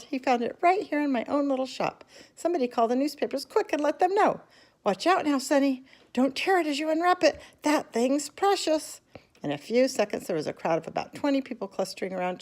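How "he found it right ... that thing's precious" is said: high, raised shopkeeper voice; overjoyed and fussing; excited yelling. A narrator's reading. Shopkeeper shouting for the papers and warning Charlie, high and excited. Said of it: "he yelled".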